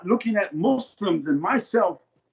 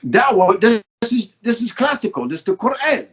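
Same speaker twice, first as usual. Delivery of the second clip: retold indignant disbelief; 5 semitones higher, 4 dB louder than usual. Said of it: "What do you mean provocative".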